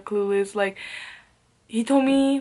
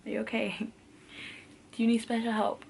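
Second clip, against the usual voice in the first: sarcastic mock concern; 2 semitones higher, 7 dB quieter than usual. Mocking the guy, then "just kidding.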